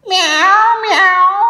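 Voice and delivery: high meowing cat. Acted. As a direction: meow like a cat. Cat noise in picture book.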